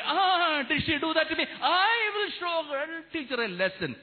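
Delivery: high-pitched indignant outburst; hammed-up angry threat; acted anger. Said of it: "Or we become angry".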